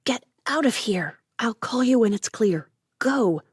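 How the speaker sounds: sharp hissed whisper; urgent whisper. A narrator's reading. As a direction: hiss it urgently under your breath. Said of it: "Judith hissed".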